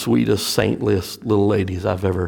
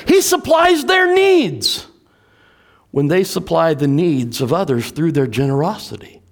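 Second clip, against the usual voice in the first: full-force preaching; 10 semitones higher, 3 dB louder than usual. Acted. Pastor preaching to the church about giving, full force. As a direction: preach it out to the congregation.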